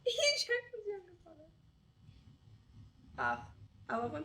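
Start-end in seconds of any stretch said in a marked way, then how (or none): 0.1-1.5 high, loud laughing echo. Real, not acted. Amused friend echoing the joke, laughing. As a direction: repeat it through a big laugh.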